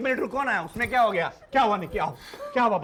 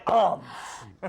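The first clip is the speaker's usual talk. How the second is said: Arms stretched slowly and low; boastful drawn-out word; 7 semitones lower, much slower than usual. Acted. Man bragging of his muscles, arms low and slow. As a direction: drop arms low and draw it out.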